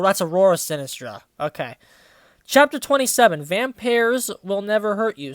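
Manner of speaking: usual speaking voice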